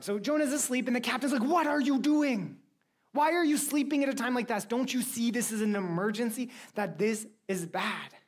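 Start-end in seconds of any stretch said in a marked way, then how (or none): 1.4-8.1 high, incredulous scolding; exasperated captain. Acted. Captain to Jonah asleep in an emergency, exasperated. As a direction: scold a sleeper in raised-pitch disbelief.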